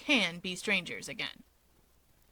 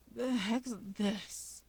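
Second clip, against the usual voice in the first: groggy grumble; 8 dB quieter, much slower than usual. Acted. Dabi waking up on the couch, groggy slow grumble. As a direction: mumble it while still coming to. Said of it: "Dabi grumbles as he comes to".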